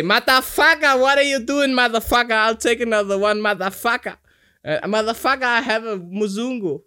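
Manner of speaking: put-on loud, high Kenyan-accented shouting, cursing freely; a rowdy Kenyan woman yelling at a rickshaw driver; Kenyan girl's voice. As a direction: belt out curses like the Kenyan girl from the story. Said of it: "She would talk like that".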